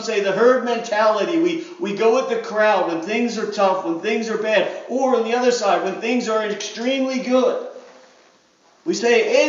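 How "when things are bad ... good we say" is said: ordinary talk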